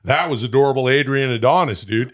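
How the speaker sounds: rally through the names like a hype man; hyped-up bro. Real, not acted.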